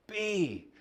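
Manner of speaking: be called out high; retold shout. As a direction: fling the word up like a shout. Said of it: "Runs around shouting".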